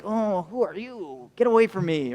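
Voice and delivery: soft, swooping voice of a wary cat; hushed mock-haughty cat; cat's imagined voice. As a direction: quietly play a cat rejecting a stranger. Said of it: "the cat will be like".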